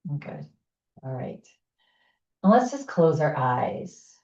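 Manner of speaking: calm, unhurried instructor voice leading a breath exercise; guided breathing. Acted. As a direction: settle the group into posture gently.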